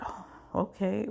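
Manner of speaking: breathy whisper, small and deflated. Retold or imitated. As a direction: let it slip out under your breath. Stunned reply to bad news.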